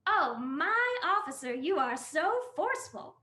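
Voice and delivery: high, slow, loud teasing purr; playful wife mock-flirting with officer; flirty wife. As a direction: tease him, drawing it out. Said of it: "a voice".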